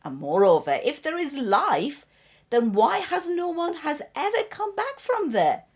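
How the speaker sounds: loud, forceful storybook rebuttal; emphatic skeptic. Acted. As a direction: hammer the argument home, pitch leaping at peaks. Acting skeptic's closing argument.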